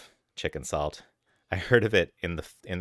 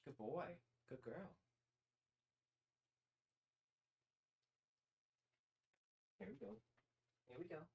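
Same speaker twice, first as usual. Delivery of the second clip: soft, much higher cooing at the cats; pet talk; 11 semitones higher, 22 dB quieter than usual. Real, not acted. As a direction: coo to your pets softly. Cooing at cats while feeding them.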